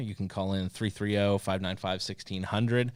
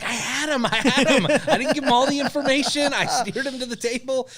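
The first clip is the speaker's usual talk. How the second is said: much higher and louder, retelling in disbelief; exasperated excitement; 8 dB louder than usual. Retold or imitated. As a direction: cry out how close you came. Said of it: "I'm like".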